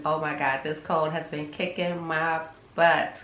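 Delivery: ordinary talk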